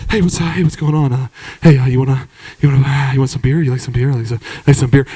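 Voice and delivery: a low, mumbling barfly voice; low talker. Retold or imitated. Jeff mumbling a beer offer to the comedian, low mumble. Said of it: "Jeff was a low talker".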